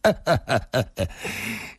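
acted string of short chuckles; dismissive chuckle. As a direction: chuckle it off, unbothered. Man laughs at her warning.

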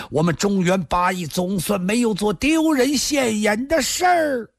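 high-pitched frenzied praise after laughing; wild exultation. A narrator's reading. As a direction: declaim it wildly, climbing to a high peak. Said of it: "易明堂突然仰面狂笑".

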